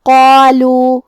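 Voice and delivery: chanted قالوا with a full, heavy Q and long aa; heavy qaf. Shown as a demonstration. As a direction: make the قا of قالوا heavy and drawn out. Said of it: "بھاری آواز میں پڑھیں گے". (قالوا میں قاف اور الف بھاری آواز میں)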